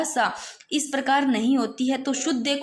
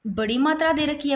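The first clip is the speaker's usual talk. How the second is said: ordinary talk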